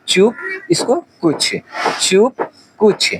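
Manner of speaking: चुप and कुछ drawn out slowly with a short u. Shown as a demonstration. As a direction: spell out चुप, कुछ gently, one syllable at a time. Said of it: "आराम से बोलेंगे इसको".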